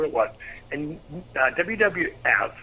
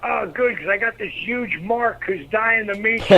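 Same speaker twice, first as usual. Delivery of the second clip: higher, slow call from the shower; imitated shout back, drawn out and pitched up; 7 semitones higher, 5 dB louder, slower than usual. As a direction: project it to someone out of sight. Rock calls out from shower.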